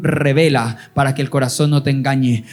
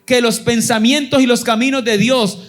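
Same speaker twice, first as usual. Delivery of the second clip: raised, high-pitched preaching over music; fervent preaching; 9 semitones higher than usual. Acted. Preacher urging his people to trust God, fervent preaching. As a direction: preach it out to the congregation with fire.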